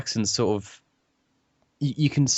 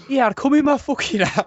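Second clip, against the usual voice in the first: high-pitched, hammed-up Scouse; bad Scouse accent; 3 dB louder than usual. Friend imitating the Scouse Bear from a clip, bad Scouse accent. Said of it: "I can't do a Scouse accent".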